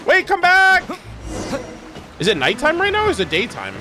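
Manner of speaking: normal talking voice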